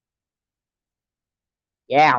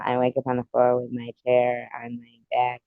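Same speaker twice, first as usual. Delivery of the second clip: ordinary delivery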